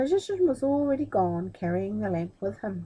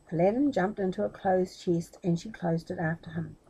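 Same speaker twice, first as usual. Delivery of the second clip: ordinary delivery, nothing marked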